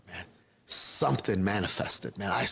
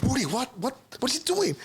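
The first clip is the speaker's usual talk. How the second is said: sharply higher, startled question; a brother suddenly alarmed at what he sees; 13 semitones higher, faster than usual. Retold or imitated. Brother catches him with bottle.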